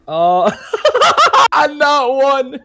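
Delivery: high, slow words broken by real laughter; laughing. Real, not acted. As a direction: laugh through it, dreading the roll. Dreading the performance check.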